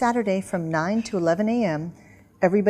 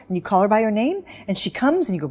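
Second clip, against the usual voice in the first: ordinary talk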